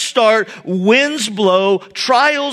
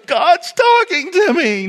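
mock freaking out; 8 semitones higher than usual. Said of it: "freaking out and traumatized".

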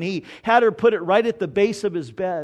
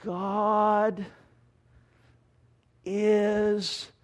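put-on little girl sounding it out; 3 dB quieter than usual. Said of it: "she goes / sound it out".

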